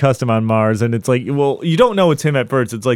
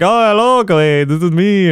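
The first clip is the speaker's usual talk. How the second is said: overly cordial greeting; 6 semitones higher than usual. Retold or imitated. Video self greets the hero, cheerfully cordial. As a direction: play it cheerfully friendly to yourself. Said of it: "super cordial and super happy".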